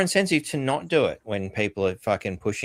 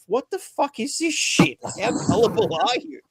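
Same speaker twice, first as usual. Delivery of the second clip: exasperated disbelief; 12 semitones higher than usual. Real, not acted. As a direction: stretch it out in disbelief.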